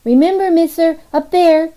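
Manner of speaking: put-on high, loud little boy shouting as he's pulled away; child calling out. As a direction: call back over your shoulder. Boy calling back while dragged.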